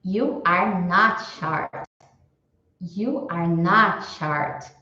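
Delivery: slow, careful English; deliberate teacher demo; slow model sentence. Shown as a demonstration. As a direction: read it slowly for learners. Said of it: "como que eu falo".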